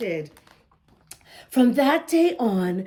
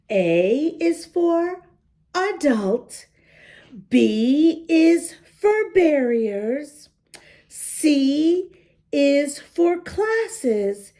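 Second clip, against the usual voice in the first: slow recital; slower than usual. Acted. Reciting the safety rules slowly.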